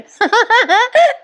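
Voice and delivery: rising high giggle. Real, not acted. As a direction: let a high giggle climb. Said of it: "sorry हो प्रेक्षकांनो".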